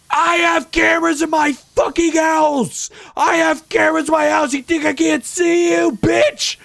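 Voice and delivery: mimicked furious scream at full volume; very high, very loud imitated yell. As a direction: screech it like an enraged woman outside. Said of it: "she was just yelling".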